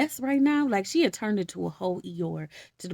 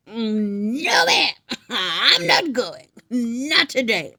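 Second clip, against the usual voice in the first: louder, drawn-out sassy no; sassy refusal; 3 semitones higher, 7 dB louder, much slower than usual. Retold or imitated. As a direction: Ruth firmly turning Naomi down with attitude. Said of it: "Ruth said".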